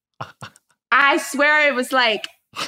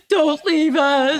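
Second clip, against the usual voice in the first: loud, high, drawn-out wail; imitated crying kids; 4 semitones higher, 5 dB louder, slower than usual. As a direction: mimic children pleading tearfully on a call. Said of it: "my kids start FaceTiming me".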